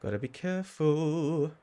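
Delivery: high, drawn-out cautionary voice; playful high-pitched "careful" warning; mock warning voice. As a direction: voice the water's warning, slow and high. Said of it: "that just says to me".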